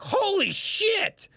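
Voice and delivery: high and slow, drawn-out holy shit; imitating his own shocked reaction; stunned exclamation. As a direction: gasp it out in awe. Retold reaction to scars.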